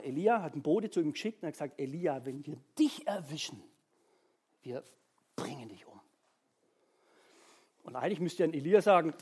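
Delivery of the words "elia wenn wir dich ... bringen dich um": sinking low to a quiet threat; menacing threat. Acted. Queen's messenger threatens Elijah, low and menacing. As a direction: let the death threat drop down into a hush.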